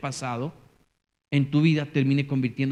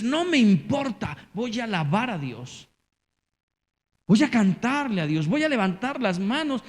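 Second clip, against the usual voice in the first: excited worshipper; 7 semitones higher, 3 dB louder than usual. Retold or imitated. Voiced worshipper deciding to praise.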